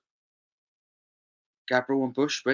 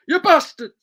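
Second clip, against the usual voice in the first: mock outburst; 9 dB louder than usual. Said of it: "It's like".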